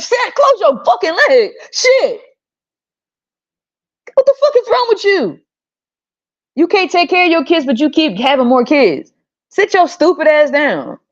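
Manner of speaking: high, shrill-free ranting scold; high outraged telling-off; angry scolding. Real, not acted. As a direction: berate her, voice way up. Scolding the mother directly.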